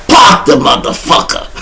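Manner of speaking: hammed-up father barking "park the motherfucker"; imitating dad. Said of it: "That's what he used to say".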